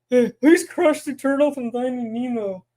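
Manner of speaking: a lightly put-on surfer turtle voice; turtle puppet voice. Puppeteer voicing the turtle asking about himself, lightly put-on puppet voice.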